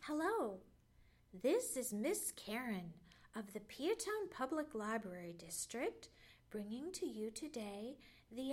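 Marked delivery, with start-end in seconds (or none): none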